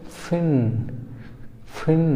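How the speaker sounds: फिन with a clear f, not p; teacher demo of फिन. Shown as a demonstration. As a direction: bite the lip for the f in फिन. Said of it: "क्या बोलेंगे?".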